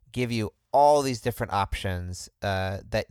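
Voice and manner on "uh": normal talking voice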